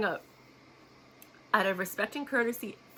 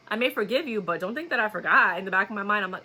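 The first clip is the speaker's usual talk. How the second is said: normal talking voice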